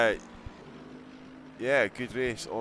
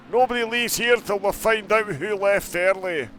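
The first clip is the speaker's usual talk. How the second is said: high, loud Scottish hardman voice; Trainspotting impression; 9 semitones higher, 7 dB louder than usual. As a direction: bark it like an angry Glaswegian. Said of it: "a poor train spot and impersonation".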